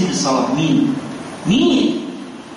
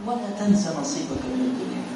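ولا تنس نصيبك من الدنيا swallowed fast and much softer; hurried mumble; 3 semitones lower, 10 dB quieter, faster than usual. Shown as a demonstration. Preacher shows people hiding the verse's second half, mumbling fast. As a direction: rush it under your breath as if hiding it. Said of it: "قولها كده، مش مش".

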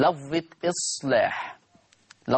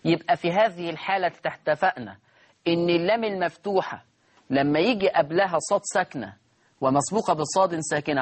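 ordinary talk

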